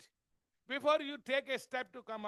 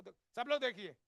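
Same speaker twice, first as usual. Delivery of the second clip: normal talking voice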